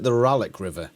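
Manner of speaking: "Ralek" with a short A, RAL-ick; preferred pronunciation. Shown as a demonstration. Correcting the quiz answer, "Ralek" said as RAL-ick. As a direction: say "Ralek" with an open, short first vowel. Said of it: "I would have pronounced it".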